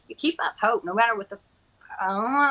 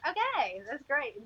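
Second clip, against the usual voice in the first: ordinary talk, nothing marked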